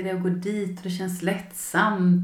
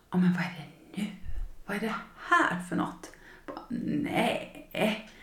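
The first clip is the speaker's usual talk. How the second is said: retold frustrated whisper; 6 dB quieter than usual.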